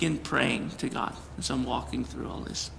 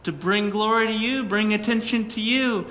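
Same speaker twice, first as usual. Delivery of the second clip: arguing with God; 7 semitones higher than usual. Retold or imitated. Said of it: "sitting there arguing".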